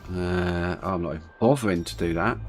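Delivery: usual speaking voice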